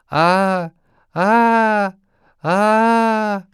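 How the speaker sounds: high, loud gasping cries of terror; a fleeing man collapsing at the peddler's feet, crying out in terror; terrified cry. Acted. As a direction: cry out in panic, rising. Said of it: "crying out".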